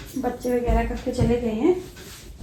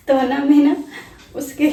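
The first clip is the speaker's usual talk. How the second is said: giggling through the words, real laughter; can barely get the words out for laughing; 3 semitones higher, 6 dB louder than usual. Real, not acted. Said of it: "इतनी हंसी आ रही है". (हँसते हुए, खिलखिलाकर)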